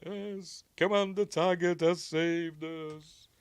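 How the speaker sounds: high, slow and let down; deflated actor. Retold or imitated. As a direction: say it like the praise went to the wrong man.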